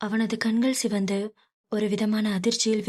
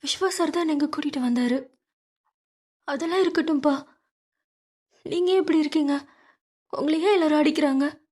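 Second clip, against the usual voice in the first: put-on lightly higher young woman, worried; Meera's voice; 7 semitones higher, faster than usual. Daughter worried, asking her father why he is beaten, lightly higher voice.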